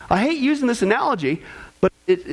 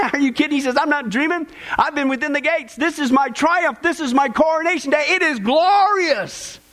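higher, ecstatic, slowing to a held glory; joyful deathbed cry; 6 semitones higher than usual. Retold or imitated. Dying father answering his son, rising ecstatic joy. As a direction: rise into triumph, stretch the last line.

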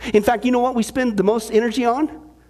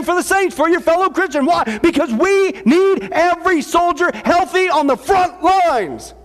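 preaching shout; 7 semitones higher than usual. Real, not acted.

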